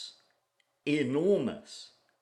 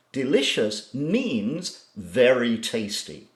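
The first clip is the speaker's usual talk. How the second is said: ordinary talk, nothing marked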